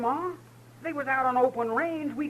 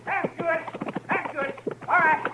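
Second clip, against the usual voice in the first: calling out; 8 semitones higher than usual. Acted. Herding horses, calling out.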